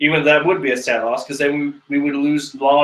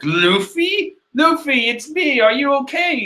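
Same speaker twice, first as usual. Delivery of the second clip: Nami voiced well up high; worried call to Luffy, high and light; high worried teammate voice; high girl voice; 9 semitones higher than usual.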